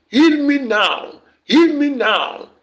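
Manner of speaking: high, slow, loud cry; shouted plea. Acted. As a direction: belt out the plea for healing. Preacher climaxing healing prayer.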